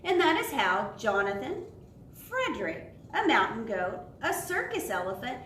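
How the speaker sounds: lively storybook read with voices for each animal; animated children's story narration. A narrator's reading. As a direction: read it aloud like a bedtime picture book. Closing refrain.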